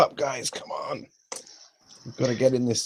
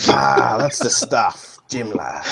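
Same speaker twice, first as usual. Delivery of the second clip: pirate voice; slower than usual. Acted. Pirate-style toast to poison.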